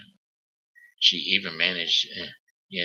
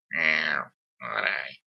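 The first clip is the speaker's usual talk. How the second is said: cat voice; 3 semitones lower, slower than usual. Pretend. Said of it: "I can't do voices anymore".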